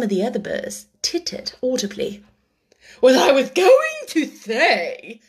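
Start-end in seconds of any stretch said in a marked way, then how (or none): 3.0-5.2 slow, louder, huffy Dodo; offended. A narrator's reading. The offended Dodo answers the eaglet, huffy. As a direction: sniff with wounded dignity. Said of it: "in an offended tone".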